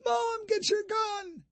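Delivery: put-on very high, slow girl's cry; a panicked girl yells for help; girl screaming. A narrator's reading. Daughter yelling at her mother to grab the gun, very high and panicked. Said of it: "Madison screamed".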